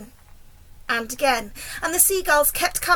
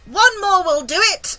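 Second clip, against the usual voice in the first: high, loud encouraging call; shout; 6 semitones higher, 7 dB louder than usual. A narrator's reading. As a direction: call out that victory is close. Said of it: "shouted the old green grasshopper".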